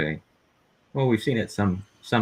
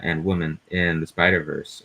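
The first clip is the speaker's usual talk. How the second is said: ordinary talk, nothing marked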